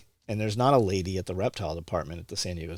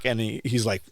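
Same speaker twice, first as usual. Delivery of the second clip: normal talking voice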